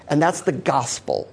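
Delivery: ordinary delivery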